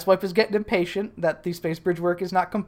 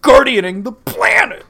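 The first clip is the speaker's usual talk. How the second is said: mock tantrum; 2 semitones higher, 8 dB louder than usual. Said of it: "throws a little tantrum and goes".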